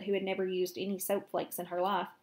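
ordinary delivery, nothing marked

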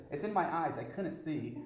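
ordinary talk

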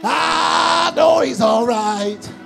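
ordinary talk, nothing marked

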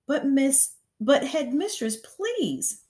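beg, voice climbing; desperate plea. A narrator's reading. Teacher begging the headmistress, voice climbing.

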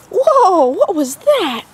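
high startled whoa; surprised whoa. Real, not acted. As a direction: caught off guard, blurt it.

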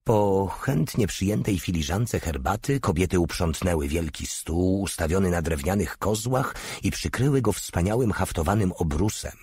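plain reading voice

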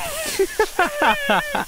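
very high giggle burst; high squealing laugh. Real, not acted. Gamer laughing after a kill, high squeal. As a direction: squeal with delight.

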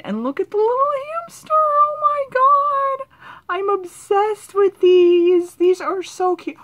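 high, gushing squeal over a cute card; excited squeal. Real, not acted. Opening a friend's valentine, she squeals with delight.

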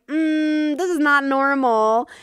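drawn-out sarcastic "this is not normal"; flat rebuttal. Retold or imitated. Answering people who say she's fine, pointed sarcasm. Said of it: "I'm like".

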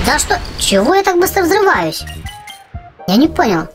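ordinary talk, nothing marked

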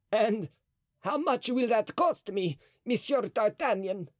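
high, anxious cardinal. A narrator's reading. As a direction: ask it high and uneasy. Mazarin speaks.

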